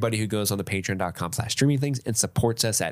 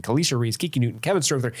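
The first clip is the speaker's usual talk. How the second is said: ordinary delivery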